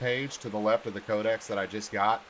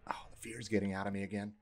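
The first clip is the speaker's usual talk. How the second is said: whispering; 7 dB quieter, faster than usual. Real, not acted. Scared player whispering in the dark.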